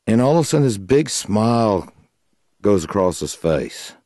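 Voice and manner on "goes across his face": ordinary talk, nothing marked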